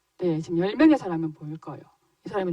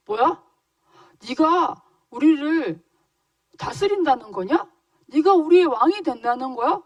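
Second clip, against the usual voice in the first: put-on indignant older brothers, louder and higher; 6 semitones higher, 5 dB louder than usual. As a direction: challenge your little brother, acted offended outrage. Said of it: "이러면서 화가 난 거예요". (화나서 따져 묻는 형들 목소리)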